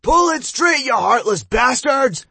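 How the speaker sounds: loud shriek of pain. A narrator's reading. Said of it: "Josh was shrieking".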